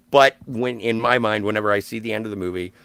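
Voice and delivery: ordinary talk, nothing marked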